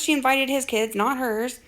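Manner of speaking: ordinary talk, nothing marked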